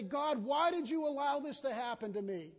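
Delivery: loud, high accusing cry; complaining to God. Retold or imitated. Believers blaming God, a loud complaining cry. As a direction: demand an answer from God. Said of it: "we look back and we say".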